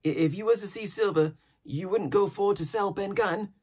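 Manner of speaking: an odd, jittery hermit rattling on; eager old castaway. A narrator's reading. Ben Gunn voiced in reading.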